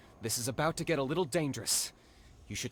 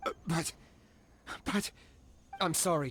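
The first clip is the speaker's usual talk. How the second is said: normal talking voice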